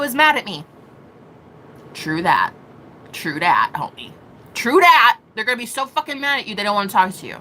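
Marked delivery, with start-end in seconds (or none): 1.9-2.5 low true that. Shown as a demonstration. 3.1-4.1 mock bro voice. 4.5-5.2 higher and louder punchy agreement; loud true that. Pretend. Mock bro agreement.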